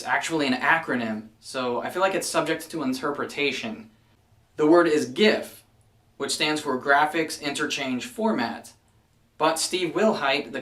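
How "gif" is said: gif said with a hard G like gift. Shown as a demonstration. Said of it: "you can pronounce it gif".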